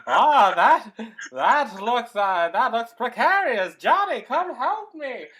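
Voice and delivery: high, loud, laughing man's voice; hammed-up high hero with a laugh in it; imitating Tim Matheson. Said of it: "you do a pretty good Tim Matheson".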